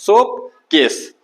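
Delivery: सोप ... केस, slow with a break; split into two syllables. Shown as a demonstration. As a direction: let the breath break after सोप, then say केस. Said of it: "naturally दो बार टूट रहा है सांस".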